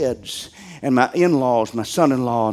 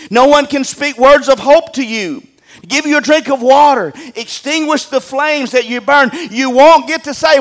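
raised, louder hellfire preaching; preaching at full force; 9 semitones higher, 7 dB louder than usual. Acted. Pastor warns the congregation about hell, preaching hard. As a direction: drive each torment home to the congregation.

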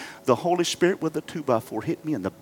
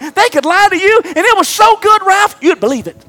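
higher, louder, driving toward hope; rising preaching; 14 semitones higher, 10 dB louder than usual. Acted. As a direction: lift the room with each line. Preaching at full force again.

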